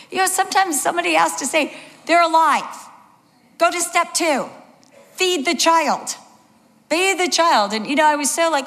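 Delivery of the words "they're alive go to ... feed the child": high, emphatic step-by-step orders; insistent coaching, each phrase falling at the end; firm instruction. Acted. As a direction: spell it out to someone in a panic. Someone firmly instructs the panicked parent.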